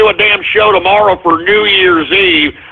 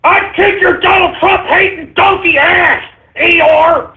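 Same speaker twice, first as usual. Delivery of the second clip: furious high-pitched screaming tirade; enraged ranting; 9 semitones higher than usual. Real, not acted. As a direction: scream insults at the prank caller, voice climbing high. Host rages at prank caller.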